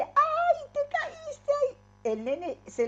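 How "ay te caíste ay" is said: much higher, louder pitying cry of a mother; alarmed mother. Retold or imitated. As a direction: gasp over the fallen child as a worried mom. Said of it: "si uno dice". (madre alarmada que se lamenta, mucho más aguda)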